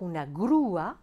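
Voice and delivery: grúa with U and A apart, stressed on Ú; "grúa" in two syllables, no glide; separated vowels. Shown as a demonstration. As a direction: break grúa into GRÚ-a. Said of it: "prononcer le U et le A séparément".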